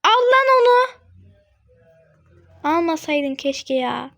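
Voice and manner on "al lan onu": much higher, louder urging; excited urging. Real, not acted. Gamer urging a teammate to grab it, excited.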